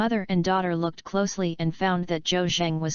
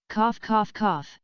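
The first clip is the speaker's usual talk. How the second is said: deliberate cough; 3 semitones higher, slower than usual. A narrator's reading. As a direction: fake coughs to get attention. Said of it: "coughed a few times on purpose".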